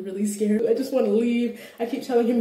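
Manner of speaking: usual speaking voice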